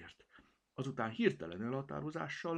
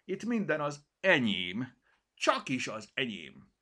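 louder, higher, puffed-up pride; proud claim; 7 semitones higher, 7 dB louder than usual. Acted. Cirmi claiming everything to the kitten, proudly. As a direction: stress minden like a boast. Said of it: "húzta ki magát".

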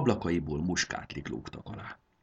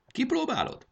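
much higher, eager invitation; inviting offer. Acted. Conductor offers the whistle to the dragon girl, eager. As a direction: tempt her to try it.

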